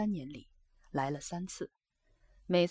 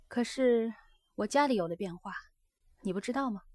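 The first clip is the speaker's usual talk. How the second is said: lightly voiced geisha, a little livelier; a hint of an earnest young geisha; geisha confiding; 5 semitones higher, 4 dB louder than usual. Geisha tells her guest of changes at home, confiding.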